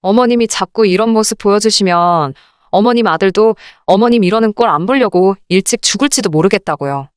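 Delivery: normal talking voice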